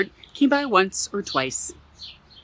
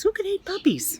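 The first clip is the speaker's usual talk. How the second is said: much higher, incredulous question; storyteller feigning shock; mock disbelief; 12 semitones higher than usual. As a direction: act shocked for the children. Mock disbelief about puppies.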